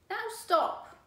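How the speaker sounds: high, loud command; firm stop. Acted. Boy orders the dog to stop, loud.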